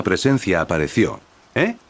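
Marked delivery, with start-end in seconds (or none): 1.5-1.8 high, rising question; mildly taken aback, pitch shooting up; surprised. A narrator's reading. Gohan reacts to a sudden presence, surprised. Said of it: "Dijo sorprendido Gohan".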